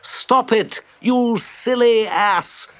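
very high, scolding cry; alarmed cry. A narrator's reading. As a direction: cry out high, drawing the words out. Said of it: "cried Rat from the bottom of the boat".

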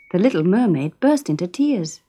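ordinary delivery

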